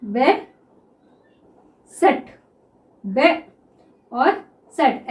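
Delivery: बे and सेट said apart with long pauses; syllable-by-syllable teaching demo; split into syllables. Shown as a demonstration. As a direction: break बेसेट into बे, then सेट. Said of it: "तो देखिए".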